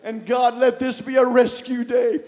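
loud, high fervent prayer; shouted pleading prayer; preaching at full force. Real, not acted. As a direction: pray it out like a revival. Leads congregation in prayer.

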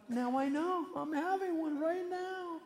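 high, drawn-out wail of realization; mock freak-out. Man deciding he's having a breakdown, high mock panic. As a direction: stretch it out high, on one held pitch.

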